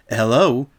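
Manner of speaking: ordinary delivery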